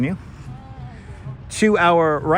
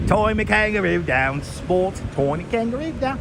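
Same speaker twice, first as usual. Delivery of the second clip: playful put-on Australian twang, sung-ish; mock Aussie accent; 4 semitones higher than usual. Cheeky vlogger greets Aussie viewer in mock Australian accent.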